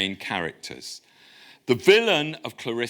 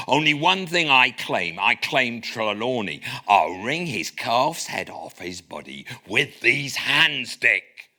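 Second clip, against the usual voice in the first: put-on cod Devon accent. Silver voting for murder to his crew, cod Devon accent. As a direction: play Silver the West Country villain, half in jest. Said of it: "read this in a sort of cod Devon accent".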